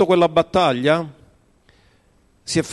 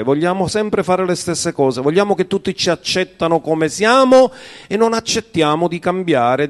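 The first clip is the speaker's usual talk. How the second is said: ordinary delivery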